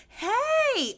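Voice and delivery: mocking her own over-the-top greeting to the stranger; extra greeting. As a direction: play up the phony cheer. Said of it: "the way I'm like".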